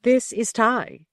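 flat echoey PA page over intercom. A narrator's reading. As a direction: announce it like a ship's PA. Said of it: "said the ship intercom. It was Ty's voice".

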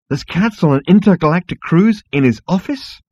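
high, loud disbelief; incredulous. Acted. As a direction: ask it in total disbelief. Incredulous question.

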